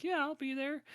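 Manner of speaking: high, breezy mock yes from Liv Tyler; airy eager actress. Liv Tyler casually agreeing to a six-day shoot, high mock-breezy. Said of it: "And she's like".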